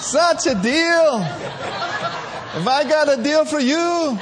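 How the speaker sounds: slow, drawn-out huckster line through laughter; laughing mock huckster; laughing sales pitch.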